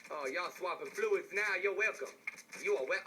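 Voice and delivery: ordinary delivery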